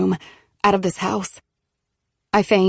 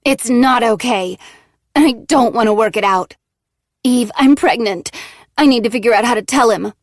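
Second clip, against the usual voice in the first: louder, higher bitter voice; venomous; 4 semitones higher, 6 dB louder than usual. Acted. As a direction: spit it out with venom. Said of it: "becomes venomous".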